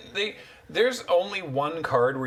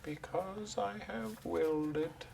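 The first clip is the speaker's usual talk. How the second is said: villain voice; 8 semitones higher, 8 dB quieter than usual. Pretend. Villain line for tutor.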